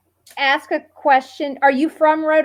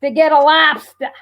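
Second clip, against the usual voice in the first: higher-pitched imitation Rhode Island accent; attempted Rhode Island accent; 5 semitones higher than usual. Nervous guest takes on the champ, higher mock Rhode Island accent. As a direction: do your best fake Rhode Island accent. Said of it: "I'm gonna do my best".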